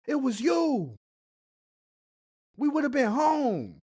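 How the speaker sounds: shocked outburst. Real, not acted. Friend hearing who opened the windbag, shocked and loud. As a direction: blurt it out in disbelief. Listener reacting to the windbag reveal.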